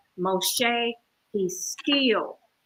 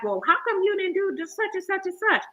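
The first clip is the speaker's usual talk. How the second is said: quick, high-pitched retold complaint; questioning Moses; 9 semitones higher, faster than usual. Moshe questioning the high priest, in a high, quick voice. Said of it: "even Moshe was like".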